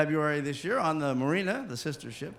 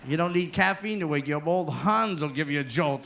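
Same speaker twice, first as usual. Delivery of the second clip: ordinary talk, nothing marked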